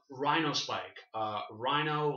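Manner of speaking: Rhino Spike said slowly and clearly; slow clarifying name; slow, careful naming. Shown as a demonstration. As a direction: spell the name out slowly so it sticks. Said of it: "like the, like".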